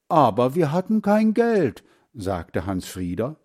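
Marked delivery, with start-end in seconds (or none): 0.1-1.8 put-on high, loud boyish objection; protesting. A narrator's reading. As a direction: protest to mother with voice raised. Boy protests to his mother. (laut und hoch protestierend)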